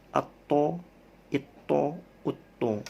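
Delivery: Atto, itto, utto with a bouncing stop on the t; qalqalah bounce. Shown as a demonstration. Teacher to class, bouncing qalqalah drill. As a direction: make the t of Atto rebound. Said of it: "Ketika sidda".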